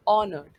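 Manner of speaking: ऑनर्ड said loud, starting on the vowel; silent h. Shown as a demonstration. Teacher announcing the word ऑनर्ड, h silent. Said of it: "H को pronounce नहीं करना".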